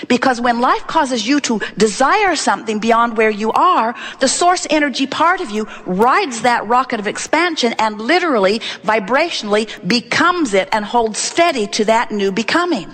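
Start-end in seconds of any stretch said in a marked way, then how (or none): none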